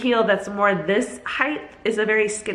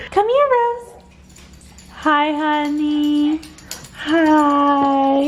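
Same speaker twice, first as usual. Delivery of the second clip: welcoming someone home; 8 semitones higher, 4 dB louder, much slower than usual. Real, not acted. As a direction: call the dog in a high, happy voice. Greeting the dog.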